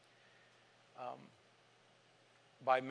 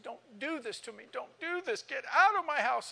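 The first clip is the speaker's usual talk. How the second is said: high tearful begging rising to a cry; retold crying plea building to a tearful outburst; 10 semitones higher, 3 dB louder than usual. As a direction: beg through tears, then break out louder on get out. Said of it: "And she cried".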